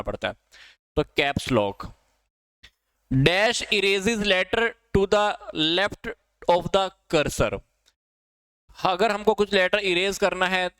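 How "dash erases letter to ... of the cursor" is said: each word read out slowly, like a dictation. Shown as a demonstration. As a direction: spell the question out for the class slowly. Reading a quiz question aloud. (कक्षा को धीरे-धीरे सवाल पढ़कर सुनाना)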